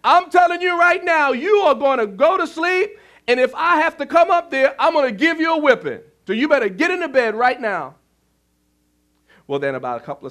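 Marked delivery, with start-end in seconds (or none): 0.0-8.0 loud and high, a furious father's shout; yelling up the stairs. Acted. Father yells up the stairs at his son, furious shout. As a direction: bellow it up to the boy's room. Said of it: "and he yells up".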